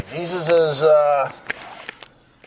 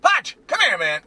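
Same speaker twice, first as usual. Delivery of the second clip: higher, called across a room; calling out; 10 semitones higher than usual. Acted. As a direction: hail him over. Calling out to Pilate.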